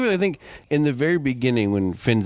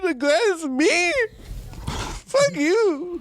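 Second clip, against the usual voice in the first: high, hammed-up tearful whine; mock crying; much slower than usual. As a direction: blubber it out, overdone. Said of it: "I'm fucking crying over here".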